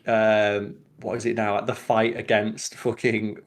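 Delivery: ordinary delivery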